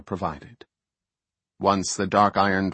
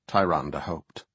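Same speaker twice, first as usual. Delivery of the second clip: usual speaking voice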